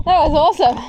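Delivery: thrilled and loud; excited. Real, not acted. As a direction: burst out with the thrill. After the cold jump.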